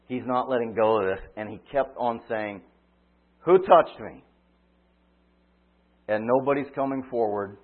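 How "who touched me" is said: raised, higher call over the crowd; loud call. Acted. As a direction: call it out, insisting. Jesus keeps asking the crowd.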